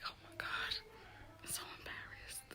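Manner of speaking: barely audible mortified whisper; embarrassed whisper. Retold or imitated. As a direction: whisper it as if wanting to disappear. Embarrassed inner reaction under her breath.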